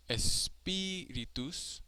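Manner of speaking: espíritus with a high, stretched í; stress on third-to-last. Shown as a demonstration. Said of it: "is the third to last".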